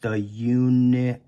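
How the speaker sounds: eunuch said slowly, sound by sound. Shown as a demonstration. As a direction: drag out the middle of unicorn. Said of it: "stretch out the word unicorn".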